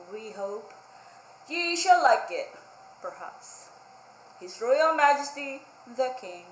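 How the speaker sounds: lightly voiced proclamation with doubtful asides; royal proclamation. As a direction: declaim it like a pompous decree. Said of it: "they posted a sign".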